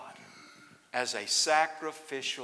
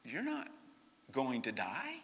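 reassuring doctor; 7 dB quieter than usual. Retold or imitated. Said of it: "he said".

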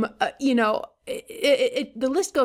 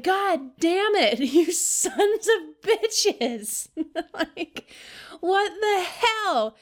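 drawn-out, higher, half-laughing curse; mock exasperated cursing; 7 semitones higher, much slower than usual. Caregiver at wit's end hamming up slow, laughing curses. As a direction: fake outrage, stretched slowly through a laugh. Caregiver venting at partner, quoted.

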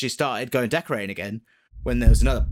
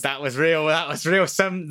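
normal talking voice

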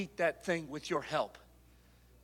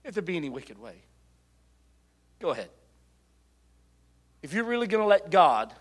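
ordinary talk

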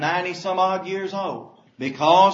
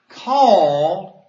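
"called" much louder, held out; stress on called; 9 dB louder than usual. Shown as a demonstration. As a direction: bring "called" out loud and apart. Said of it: "look at the next word".

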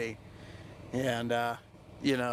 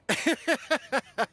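high chuckling burst; self-mocking laugh; 10 semitones higher, 3 dB louder than usual. Real, not acted. Man admitting that was a failure, self-mocking laugh. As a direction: laugh at your own failure.

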